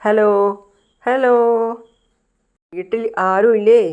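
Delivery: drawn-out call into the house. A narrator's reading. Visitor at the door of an empty-seeming house, calling out. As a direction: call to see if anyone is home. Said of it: "വന്നിട്ട് വിളിക്കുന്നത്".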